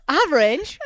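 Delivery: "range" said high and loud through a laugh; laughing boast. Real, not acted. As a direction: brag about your voice while laughing.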